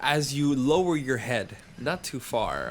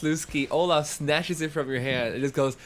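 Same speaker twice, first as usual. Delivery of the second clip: ordinary delivery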